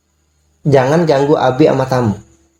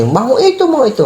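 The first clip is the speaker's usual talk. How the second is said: put-on high, whiny child begging for it; imitating a child whining for a toy; 10 semitones higher than usual. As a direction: beg like a sulky toddler.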